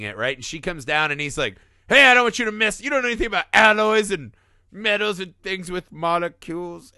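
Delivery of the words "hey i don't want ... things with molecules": higher, louder know-it-all lecture; condescending Ash. Retold or imitated. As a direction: brush her off like a smug expert.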